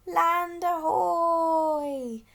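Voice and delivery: squawk. A narrator's reading. Snow petrel announcing land, long loud squawk. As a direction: squawk it like a seabird. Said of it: "squawked the snow petrel".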